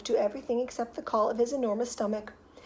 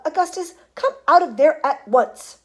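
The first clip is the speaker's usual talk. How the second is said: high, loud stern order; loud scolding; 6 semitones higher, 10 dB louder than usual. A narrator's reading. Mother waving umbrella at stuck son, loud scolding. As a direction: order him out loudly.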